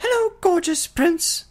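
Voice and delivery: very high, loud shrill creature shout; sudden shout. A narrator's reading. A creature bursts in, shouting a greeting. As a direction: yell it out of nowhere. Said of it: "The sudden shout from the creature".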